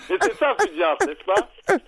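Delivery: high, giggling through the words; talking while trying not to laugh; stifled giggles. Real, not acted. Talking through his own laughter.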